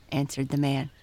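plain reading voice

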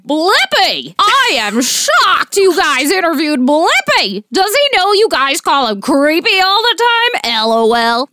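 high, loud cartoonish reading voice; shrill mock-fan voice reading a review out loud; crazy voice. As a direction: read the review in a wacky put-on voice. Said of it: "just do a crazy voice".

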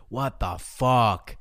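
slow, drawn-out mock grumble; lightly voiced irritated celebrity; imitating angry actor. Retelling the annoyed actor at baggage claim, slow mock grumble. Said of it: "he's like".

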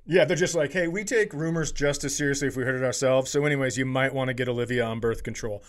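ordinary talk

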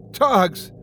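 a high, breathless cry of "Togs!" with no air; gasped out. Acted. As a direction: call his name while the chain cuts off your breath. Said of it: "I gasp out".

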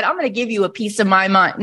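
usual speaking voice

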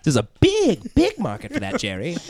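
mock a showy seller; mock character voice. Voicing a character, raised.

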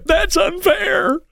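very high, slow, put-on protest; mock griping. People griping about expenses, mock high protest. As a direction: stretch it out like a falsetto tantrum. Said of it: "the same people that are griping about expenses".

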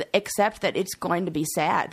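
usual speaking voice

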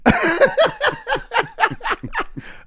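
long, squealing laugh at your own joke; high-pitched laughing fit. Real, not acted. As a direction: burst into high, helpless laughter. Cracks up at own impression.

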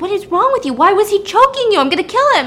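high, loud acted outrage; outraged. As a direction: play an alarmed, furious husband, voice jumping up. Acted husband outraged at attack.

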